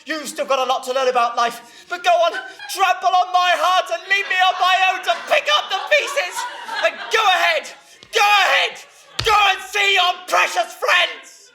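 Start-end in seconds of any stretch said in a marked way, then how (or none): 1.9-11.5 high, loud, hammed-up guilt trip; melodramatic martyr. Mother sending Ivan off to his friends, loudly martyred. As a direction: build to a wounded, shouted climax.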